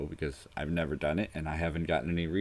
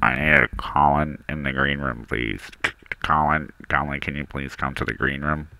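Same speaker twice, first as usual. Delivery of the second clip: low, loud paging announcement; announcer voice; 6 semitones lower, 5 dB louder than usual. Pretend. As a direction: page him like a PA call. Paging Colin.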